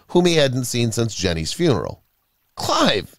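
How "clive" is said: high-pitched call of recognition; astonished exclamation, pitched up; startled cry. A narrator's reading. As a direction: cry out to a friend in surprise. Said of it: "he cried".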